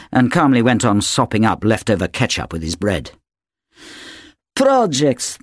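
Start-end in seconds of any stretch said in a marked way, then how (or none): 4.5-5.4 the word Projects spat out high with contempt; scoffing. A narrator's reading. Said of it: "Natasha scoffs at school".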